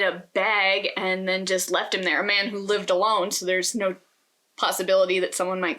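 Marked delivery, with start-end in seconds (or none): none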